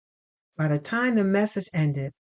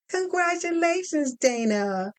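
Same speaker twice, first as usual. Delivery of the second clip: higher, bright professional phone voice; HR woman on the phone; 9 semitones higher than usual. Acted. Lynn from HR announcing finalist news, higher brisk phone voice. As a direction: sell the good news like a cheerful recruiter.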